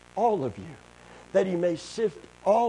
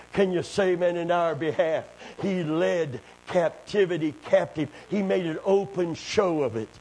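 normal talking voice

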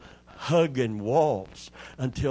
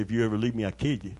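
low, quiet mock threat to a wife; 6 semitones lower, 7 dB quieter, much faster than usual. Husband jokingly threatening his wife, low mock menace. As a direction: growl a pretend death threat, lightly. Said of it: "threatened her and said".

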